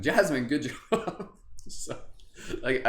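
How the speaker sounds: ordinary talk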